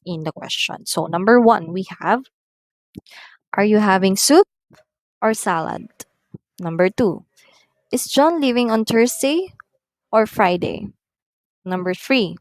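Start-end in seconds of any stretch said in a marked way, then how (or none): 3.5-5.9 "soup" rising, "salad" falling at the end; rise-fall choice question. Shown as a demonstration. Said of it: "the first word or choice is being risen up". 7.9-10.9 "Thursday" pitched up, "Friday" falling; rise-fall choice question. Shown as a demonstration. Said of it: "the first word or choice is being risen up".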